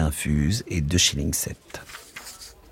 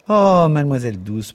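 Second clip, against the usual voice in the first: higher, mock-shocked protest; 8 semitones higher, 3 dB louder than usual. A narrator's reading. Miss Kennedy protesting her friend's crude remark, mock-shocked. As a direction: scold her friend in playful outrage. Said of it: "protesta mademoiselle Kennedy".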